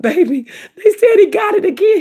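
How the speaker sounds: high, strained tearful cry of bad news; breaking down as she tells her son the news; distraught cry. Mother telling her son of the relapse, high tearful cry.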